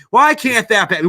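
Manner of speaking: mock-furious shout at the camera; ranting yell. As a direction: fire the question at the camera. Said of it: "that's my fucking rant".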